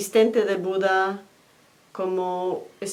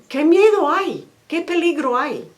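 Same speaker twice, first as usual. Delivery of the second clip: higher, louder urgent asking; alarmed question; 8 semitones higher, 5 dB louder than usual. Acted. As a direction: call out to a running friend. Herdsmen call out asking him.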